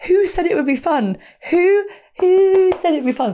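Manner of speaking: high, incredulous retold outburst, slowing down; mock panicked protest. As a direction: stretch the repeated "who" high and loud.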